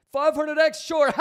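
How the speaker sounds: shouted high, laughing through it; mock hype. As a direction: blurt it out as a loud joke. Mocking a bad trade call.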